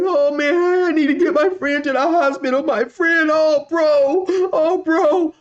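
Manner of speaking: high, tearful wail of a desperate stranger; mock crying dude. Driver sobbing about his friend.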